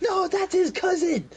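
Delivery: high, loud correction; guard yelling the correction. Acted. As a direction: shout the correction down the hall. Guards shouting across hallway.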